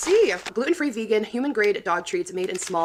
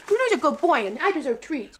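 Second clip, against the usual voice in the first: high squeaky voice speaking as her dog; 7 semitones higher than usual. Pretend. Speaking for her dog Bruno, high cute dog voice. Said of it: "about my dog voice".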